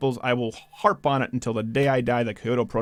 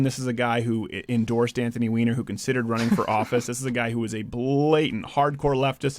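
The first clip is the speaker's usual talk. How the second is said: ordinary talk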